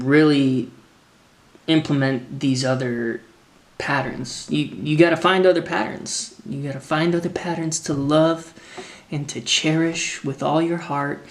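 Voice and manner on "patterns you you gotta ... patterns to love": ordinary talk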